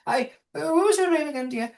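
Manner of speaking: put-on a fluttery, slightly senile old matriarch; old gnome woman. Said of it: "She goes".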